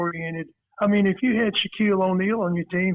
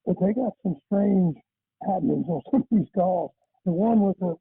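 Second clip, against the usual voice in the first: normal talking voice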